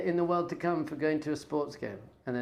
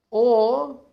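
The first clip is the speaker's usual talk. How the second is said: a big, loud drawn-out or; dramatic or; 6 semitones higher, 10 dB louder than usual. Acted. Setting up the choice for viewers, with a loud dramatic or. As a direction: punch the turn in the question.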